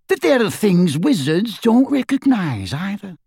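rough wizard voice. A narrator's reading. Dirk quoting goblin.

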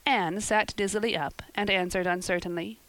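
usual speaking voice

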